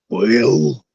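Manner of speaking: low gravel-free growl, a hint of Macho Man; Randy Savage voice. Randy Savage mutters to his opponent in a hinted, low Macho Man voice.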